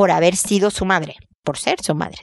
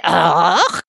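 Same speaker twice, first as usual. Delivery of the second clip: disgusted ugh; 4 semitones lower than usual. Retold or imitated. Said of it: "me hizo una cara de".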